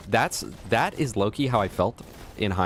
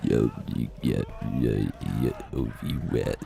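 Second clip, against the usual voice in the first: deep voice; 11 semitones lower, 3 dB quieter than usual. Acted. Deep mumbled bribe.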